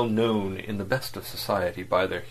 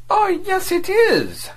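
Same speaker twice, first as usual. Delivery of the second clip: a much higher, louder snap; snapped; 7 dB louder, slower than usual. A narrator's reading. Diplomat cutting down his agent, a loud high snap. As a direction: snap it back sharply. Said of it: "snapped Mister Vladimir".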